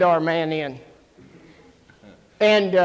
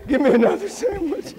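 laughing demand; 7 semitones higher than usual. Retold or imitated. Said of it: "come back and say".

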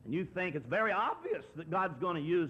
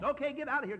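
higher, fed-up dismissal; exasperated Pharaoh; 10 semitones higher, faster than usual. Retold or imitated. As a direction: wave them off in mock exasperation.

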